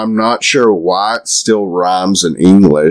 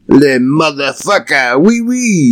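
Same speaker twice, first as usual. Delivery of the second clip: much higher mock French accent, climbing up on Oui, oui; a silly hammed-up French caricature; bad French accent; 8 semitones higher than usual. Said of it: "me doing a bad French accent".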